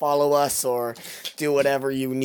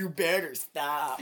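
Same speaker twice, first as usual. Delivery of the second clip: slow drawn-out villain catchphrase; Scarecrow slogan; 5 dB quieter, slower than usual. Retold or imitated. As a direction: drag out the catchphrase. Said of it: "his slogan is".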